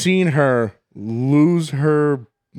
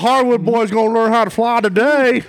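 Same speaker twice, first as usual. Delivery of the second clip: hammed-up Southern good ol' boy whoop, higher and louder; Southern accent; 7 semitones higher, 5 dB louder than usual. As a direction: holler it like a Dukes of Hazzard fan. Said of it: "that's a pretty good accent".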